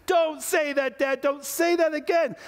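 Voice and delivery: high, strained mock child's yell; yelled back. Son begging dad to stop, high-pitched yell. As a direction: plead in a high, wailing kid voice. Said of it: "yelled back".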